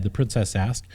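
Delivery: ordinary delivery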